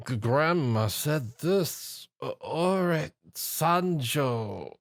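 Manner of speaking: slow, groggy wheezing mumble; dazed wheeze. A narrator's reading. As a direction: mumble like you've been knocked out. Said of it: "he wheezed out in a daze".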